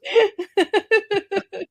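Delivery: slow, high, laughing through it; laughing agreement. Real, not acted. Host still laughing at the joke, slow and high. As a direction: drawl it out mid-laugh.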